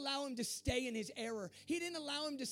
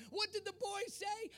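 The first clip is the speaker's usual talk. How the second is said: usual speaking voice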